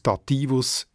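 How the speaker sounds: Dativus stressed on middle -TI-; accent the second syllable of Dativus; Latin stress. Shown as a demonstration. Editor showing the older form, Dativus stressed on -ti-. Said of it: "die Betonung vorgerutscht ist".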